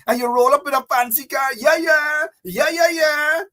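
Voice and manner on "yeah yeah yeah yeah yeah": mock cheering. Mocking crowds cheering politicians.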